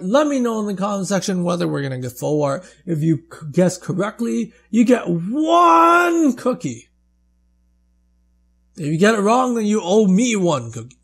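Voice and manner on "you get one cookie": playful shout. Pretend. Playful cookie promise.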